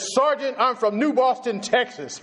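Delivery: high and proud, chest out; a recruit puffing himself up. Retold or imitated. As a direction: announce it like it means something. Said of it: "I raised my voice like it meant something".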